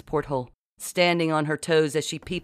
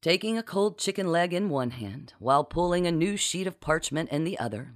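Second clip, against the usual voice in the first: plain reading voice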